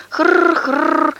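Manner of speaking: high put-on snore; mock snoring. A narrator's reading. Neighbour imitates her pug's snore for mother.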